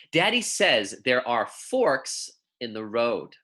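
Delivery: put-on clear, measured picture-book reading for young kids; reading to children. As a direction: read it gently to second graders, savouring each pun. Reads picture book aloud.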